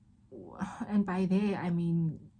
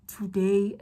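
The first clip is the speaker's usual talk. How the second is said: real laughing; 4 dB louder than usual. Real, not acted. Admitting to repeated crying today, laughing. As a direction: break into a laugh on the word. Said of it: "And now I'm laughing".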